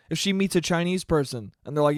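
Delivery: ordinary talk, nothing marked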